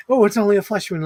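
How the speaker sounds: a hint of the brave knight, breezy; Monty Python quote. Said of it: "'Cause if you're going".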